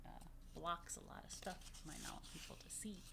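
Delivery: soft unvoiced whisper. Real, not acted. Teacher explaining the sail to the kids in a whisper. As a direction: whisper like sharing a secret. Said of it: "whispered secret aside".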